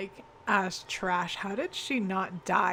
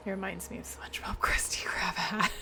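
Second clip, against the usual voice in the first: laughing whisper. Real, not acted.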